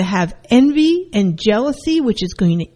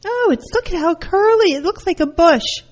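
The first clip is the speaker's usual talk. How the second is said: much higher, scornful mother's voice; mocking mom; 9 semitones higher than usual. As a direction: sneer at the curls like mom. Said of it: "she goes".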